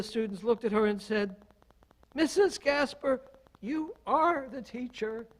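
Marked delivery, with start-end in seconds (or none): 2.1-5.3 high-pitched imitation of a student; student's retort. Student looks at her and answers, high-voiced retort. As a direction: pitch it up as a kid pointing out the obvious.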